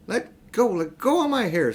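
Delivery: put-on girl's cry. A narrator's reading. Girl fights witch's grip.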